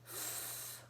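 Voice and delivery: f, a long breathless hiss through teeth and lip; just air for f, no voice; teacher modelling the f sound. Shown as a demonstration. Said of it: "it says sound".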